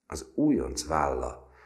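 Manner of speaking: ordinary delivery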